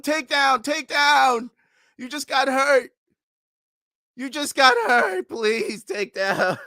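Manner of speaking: very high, loud desperate screams at the screen; frantic screaming. Real, not acted. Fan watching his fighter, screaming desperate pleas. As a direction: yell as if the fight hangs on it.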